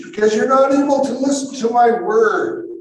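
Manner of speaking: high, loud rebuke; Jesus. Acted. Jesus answering those who don't understand, rebuking. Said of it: "Jesus said to them".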